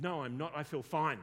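loud, emphatic retort; mock loud protest. Client contradicts counsellor about being fearful, loud mock protest. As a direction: imitate a man insisting loudly. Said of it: "Just, okay, tone it down".

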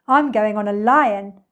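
put-on boisterous child claiming a ride; loud cry. A narrator's reading. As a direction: call out your choice loudly. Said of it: "cried a third one".

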